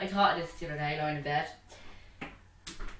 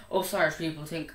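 normal talking voice

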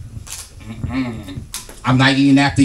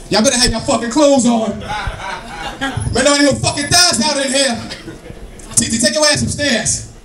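high, raised telling-off; threatening dad yell; 8 semitones higher than usual. Acted. Dad ordering teenage girls upstairs, threatening yell. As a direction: yell orders like a fed-up father. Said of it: "you have to yell in that threatening voice".

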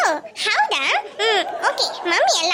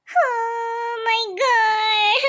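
crying; much slower than usual. Acted. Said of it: "ಅವಳನ್ನ ಅಳ್ಬೇಡಿ ಅಂತ ಹೇಳಿ".

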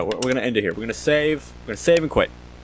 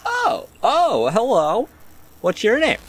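startled gamer; 10 semitones higher, slower than usual. Real, not acted. Sudden figure appears in game.

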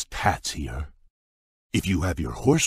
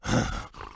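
weary sigh; 4 semitones higher, 5 dB quieter than usual. Acted. Book falls apart again.

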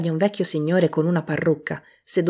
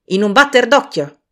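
loud, high, hearty urging; cheery boss's command; brisk jovial order; 5 semitones higher, 6 dB louder than usual. A narrator's reading. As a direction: hurry the lads along. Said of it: "proseguì il vecchio Fezziwig, battendo rapido le mani".

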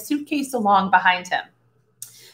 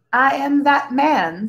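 modestly; 4 semitones higher, slower than usual. A narrator's reading. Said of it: "said Tense modestly".

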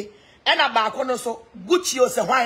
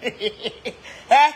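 laughing yelp. Real, not acted. Laughing outburst.

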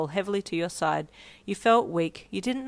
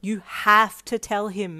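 slow, louder insistence; pressuring; 3 semitones higher, 6 dB louder, slower than usual. Acted. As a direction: press it firmly, word by word. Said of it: "May pressured".

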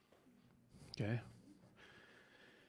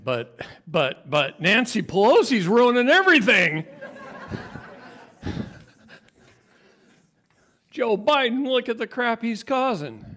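loud, high-pitched retold protest; sputter, then climb into a loud high whine of complaint; really high voice; 12 semitones higher, 12 dB louder than usual. Man protesting to colleague about politicians, voice really high. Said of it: "My voice gets really high".

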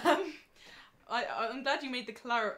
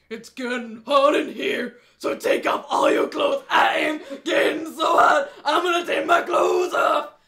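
demonic layered voice; 7 semitones higher, 19 dB louder than usual. Pretend. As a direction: bellow it like a demon. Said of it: "I actually possess a great demon voice".

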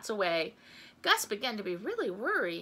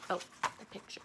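ordinary delivery

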